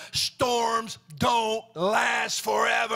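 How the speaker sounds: loud, slow, every word hammered; slow emphatic proclamation. Acted. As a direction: proclaim it slowly and hard. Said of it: "did you hear me? I said".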